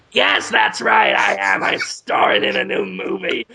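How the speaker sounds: Willem Dafoe voice, lightly done; bickering voices in one. Pretend. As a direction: answer as the penis star. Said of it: "I'll do all the voices".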